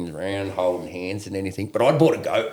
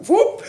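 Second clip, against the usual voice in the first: a sudden high squeaky "whoop"; mock sound effect; 6 dB louder than usual. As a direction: toss off a playful high "whoop". Mimicking the gun going off.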